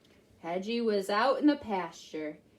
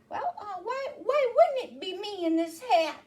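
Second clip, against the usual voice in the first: Hedgie voice; 8 semitones higher than usual. Acted. Hedgie speaks in story.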